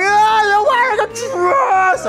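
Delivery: high, drawn-out hammed-up wail; mock astonished cry. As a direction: imitate schoolboys losing their minds. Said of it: "You're like".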